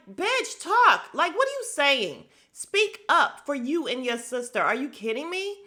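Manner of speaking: high, loud, fed-up shout; exasperated yell. Retold or imitated. Yelling at Kyle through the screen, high and loud. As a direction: yell at her to speak up.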